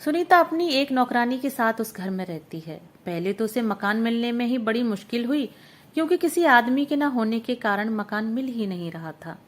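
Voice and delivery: ordinary delivery, nothing marked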